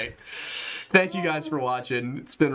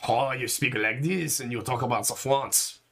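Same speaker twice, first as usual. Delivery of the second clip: mock French accent. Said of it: "the worst accent in the world".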